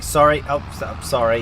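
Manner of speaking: sudden loud "Sorry." blurted out; startled loud apology. Real, not acted. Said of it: "Cut that".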